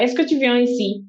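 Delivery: standard French question, slightly fast; a crisp textbook French question. Shown as a demonstration. Teaching a listener the textbook French way. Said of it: "that's what you study".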